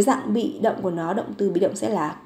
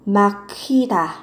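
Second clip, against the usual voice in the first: slow model word; much slower than usual. Shown as a demonstration. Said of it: "Ở cái dạng bị động của nó sẽ là".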